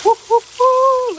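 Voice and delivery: high, loud drawn-out whoops; thrilled whooping at the griddle; excited whoops. Real, not acted. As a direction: cheer at the sizzling bacon. Excited sizzle cheer.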